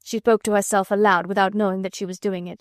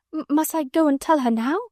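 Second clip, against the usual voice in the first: nervous stammer; 6 semitones higher than usual. A narrator's reading. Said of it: "panted Miss Amelia".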